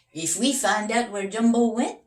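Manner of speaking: proud detective pointing out the tracks; eager detective Big Max. Acted.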